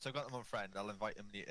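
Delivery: usual speaking voice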